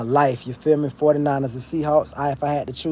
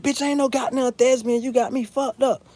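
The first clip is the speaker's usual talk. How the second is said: much higher, loud mock-angry mom voice; imitating an outraged mother, pitched way up; mom cussing out; 12 semitones higher, 4 dB louder than usual. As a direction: shriek it like his mom telling him off. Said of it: "she cussed me the fuck out".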